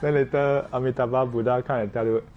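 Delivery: normal talking voice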